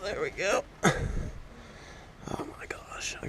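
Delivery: slow whispered oh my gosh; whispering. Real, not acted. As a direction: whisper under your breath. Fiddling with the leaking hose.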